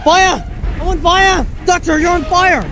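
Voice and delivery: high, loud, frantic cries; panicked screaming. Acted. The Doctor and companion screaming as he catches fire, panicked.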